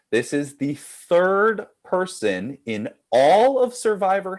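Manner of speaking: deliberate, measured quiz question; slow, careful reading. Real, not acted. Host reads a tricky question to players, slow and careful. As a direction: read it out slowly in separate phrases. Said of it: "I'm gonna read it slowly".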